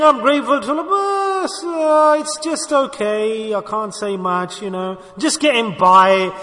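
hammed-up reluctant gratitude; mock complaining. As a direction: grumble your thanks as if life is a burden. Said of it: "and we're complaining".

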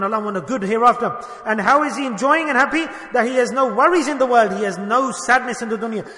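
loud, high preaching to the gathering; preaching at full force. Acted. Preacher to his listeners: loud, high, fervent preaching. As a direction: drive it home to the congregation at full voice.

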